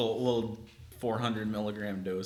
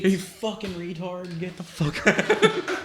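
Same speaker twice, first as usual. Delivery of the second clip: mock rude yell; 8 semitones higher, 4 dB louder, slower than usual. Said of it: "I wanted it to sound like".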